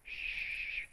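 breathy shush with finger on lips. Pretend. God shushing Jeremiah's excuses, a soft shh. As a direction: hush them sharply. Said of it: "God is going like this".

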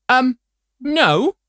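hammed-up indignant no, fading into stammering; mock flustered spokesman.

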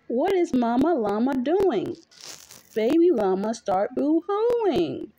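ordinary delivery

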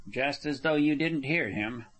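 ordinary delivery

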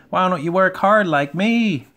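high, loud cheeky taunt; whistling train engine. A narrator's reading. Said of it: "he would whistle".

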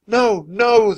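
high, loud frantic cry; a boy panicking in his sleep-haze. Acted. Feverish drummer boy to Annie, frantic. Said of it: "He sounded frantic".

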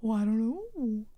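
ordinary talk, nothing marked

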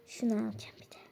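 soft unvoiced whisper; whispered packing note. Real, not acted. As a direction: breathe it as a quiet aside. Child packing, whispering aside.

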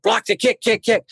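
imitated coach, pitched high; rival coach's call. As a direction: mimic a coach urging on a fighter. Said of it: "He's, she's like".

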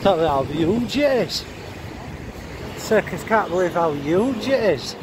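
usual speaking voice

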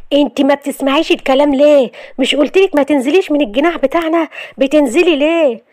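a lightly voiced angry husband telling her off; scolding husband.

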